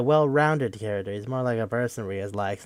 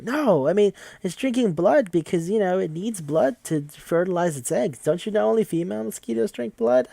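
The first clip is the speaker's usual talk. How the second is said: higher-pitched, earnest pleading; earnest protest; 8 semitones higher than usual. Retold or imitated. Squishy guy defending a mosquito's life, earnest protest.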